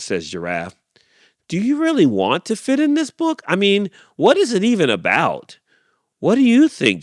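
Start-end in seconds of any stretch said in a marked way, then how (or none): 1.5-7.0 high, quizzical little animal; high critter voice. Acted. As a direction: ask it up high and puzzled. Animal voice in story.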